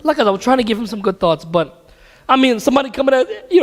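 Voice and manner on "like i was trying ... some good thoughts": ordinary delivery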